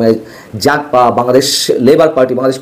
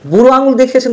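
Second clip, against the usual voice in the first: "বুড়ো" jumps much higher in a mocking sing-song; a sneering, pitched-up "বুড়ো আঙুল দেখিয়েছেন"; sarcastic repeat; 9 semitones higher, faster than usual. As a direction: echo the phrase back, higher and scornful.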